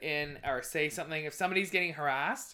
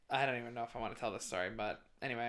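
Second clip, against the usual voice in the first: quiet; 7 semitones lower, 10 dB quieter, faster than usual. Real, not acted. Reluctant, trailing off.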